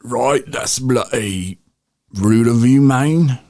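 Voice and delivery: put-on high, slow, botched Australian accent; a badly faked Australian accent. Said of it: "That was Australia".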